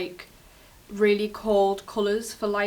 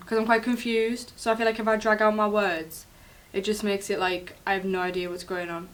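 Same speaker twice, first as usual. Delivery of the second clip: normal talking voice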